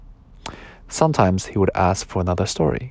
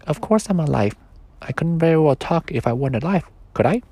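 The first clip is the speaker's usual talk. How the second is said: much higher, pleading little creature; a tiny voice begging not to be left; small toy voice; 7 semitones higher than usual. Acted. Sleepy's voice.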